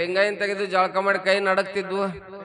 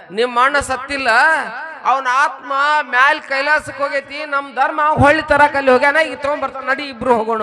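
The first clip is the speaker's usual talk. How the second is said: high, raised voice of a woman saint insisting; emphatic saint's assurance; 6 semitones higher, 5 dB louder than usual. Retold or imitated. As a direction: act out her bold, ringing assurance. Acting Bhimamma insisting brother lives. (ಭೀಮಮ್ಮನಂತೆ ಎತ್ತರದ ದನಿಯಲ್ಲಿ ದೃಢ ಭರವಸೆ)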